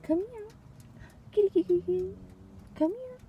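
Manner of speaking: very high, soft coaxing call; cat-calling voice. Real, not acted. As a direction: sweet-talk the kitty in a high sing-song.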